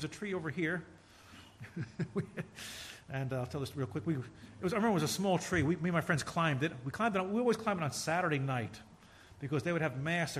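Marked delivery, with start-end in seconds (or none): none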